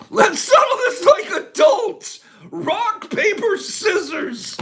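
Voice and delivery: high, loud, mock-serious call-out; playful challenge. Settling a choice with the viewers by rock paper scissors, playful high challenge. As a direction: call it high, drawing out the last words.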